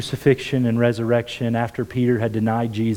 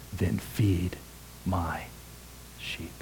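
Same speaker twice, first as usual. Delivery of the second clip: softer and lower word by word until only a whisper is left; fading to a whisper; 5 semitones lower, 12 dB quieter, much slower than usual. Retold or imitated. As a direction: let Jesus' last words sink into a breath. Jesus' tender final words retold.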